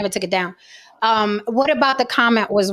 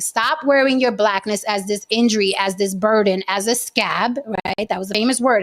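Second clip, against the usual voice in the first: ordinary talk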